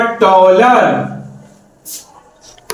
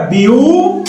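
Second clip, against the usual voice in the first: View held out on a rising tune; first syllable alone; 4 semitones higher, much slower than usual. Shown as a demonstration. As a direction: sound out view by itself, rising. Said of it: "तोड़ेंगे तो देखिए पहला syllable". (ब्यू को अलग, खींचकर बोलें)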